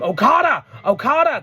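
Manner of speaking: high, loud mock call for help; imitated shout. Wrestler on the ropes calling to his partner, imitated shout. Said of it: "And he shouts".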